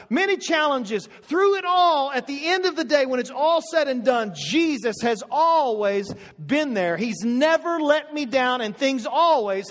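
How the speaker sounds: preaching through it all with passion; rising preacher fervor. Acted. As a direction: build the refrain with fervor. Preaching builds up.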